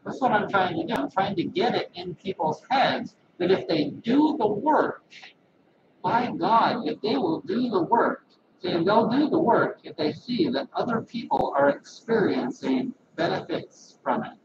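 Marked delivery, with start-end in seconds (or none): none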